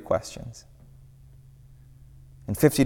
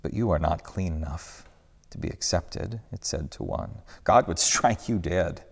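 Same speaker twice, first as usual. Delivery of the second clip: low, soft, cold accusing voice; accuser's whisper; 5 semitones lower, 7 dB quieter than usual. A narrator's reading. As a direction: hiss the doubt down low. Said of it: "the familiar whisper".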